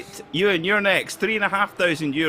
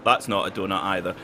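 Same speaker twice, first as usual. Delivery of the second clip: ordinary talk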